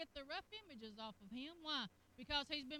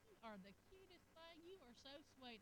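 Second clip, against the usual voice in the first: softer, tender compliment; sweet husband; 14 dB quieter than usual. Retold or imitated. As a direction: coo it gently to your wife. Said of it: "he will say something like".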